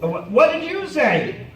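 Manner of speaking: usual speaking voice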